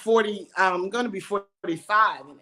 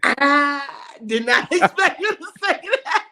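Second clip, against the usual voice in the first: high, loud and laughing through the words; laughing disbelief; 7 semitones higher, 4 dB louder than usual. Retold or imitated. Retelling his reaction to the crude reply, laughing loudly. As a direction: crack up while saying it.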